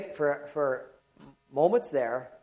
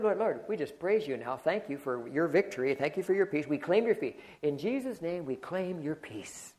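softer, hushed prayer to the Lord; quiet prayer; 8 dB quieter than usual. Acted. Pastor reenacting the roadside prayer with his wife, hushed prayer.

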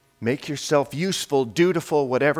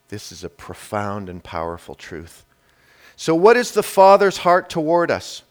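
normal talking voice

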